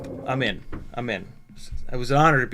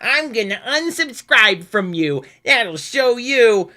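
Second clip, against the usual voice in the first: high, slow sneering mimic of a petty viewer; mock whiny threat; 7 semitones higher, 5 dB louder, slower than usual. Mocking an unsubscribing viewer's petty threat. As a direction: parody the huffy commenter, drawn out.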